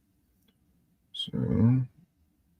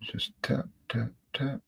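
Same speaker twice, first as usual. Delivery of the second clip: soft murmured whisper; 3 semitones higher, 11 dB quieter than usual. Real, not acted. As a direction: breathe the words while working. Painter murmuring while dabbing.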